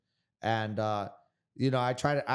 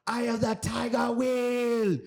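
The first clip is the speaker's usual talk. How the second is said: much higher, shouted "Will"; coach's shout; 10 semitones higher, slower than usual. Retold or imitated. Fighter imitating his coach Sangtanoi hyping him up, high shout. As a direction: bellow it like a Thai coach firing up his fighter. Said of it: "he would be like".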